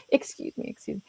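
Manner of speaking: soft, mock meek apology; apologetic. Leader excusing themselves to staff, apologetic. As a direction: shrink into a small, nearly voiceless excuse. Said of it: "an apologetic kind of like".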